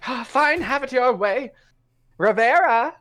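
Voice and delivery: high, theatrical rogue greeting; swashbuckling cat rogue. Acted. GM voicing a rogue greeting Zenith, high and theatrical. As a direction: play the dashing cat thief, grandly. Rogue gives up, calls ally.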